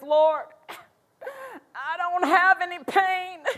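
a worried person praying the problem through tears; mock desperate prayer. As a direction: play the anxious believer crying out about the pain. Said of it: "someone comes and says".

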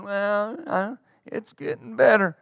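soft, whining complaint; mock a weak, whiny answer; half-hearted sickly whine. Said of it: "Faith doesn't whine".

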